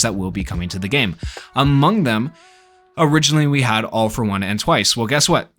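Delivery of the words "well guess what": ordinary talk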